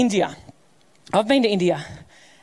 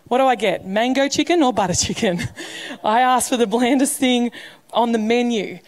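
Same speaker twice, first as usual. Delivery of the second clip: ordinary delivery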